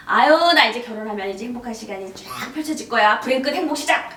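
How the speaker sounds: loud, hopeful proclamation; gleeful anticipation. Retold or imitated. Young woman dreaming of marriage, loud excited hope. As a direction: declare it brightly, building to a triumphant finish. Hopeful bride's past thought acted.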